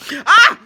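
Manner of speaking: abrupt, high, very loud "ah"; sudden loud cry. Real, not acted. Storyteller interrupts herself, sudden loud cry. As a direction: burst out with one sharp, high cry. Sudden outburst, then apology.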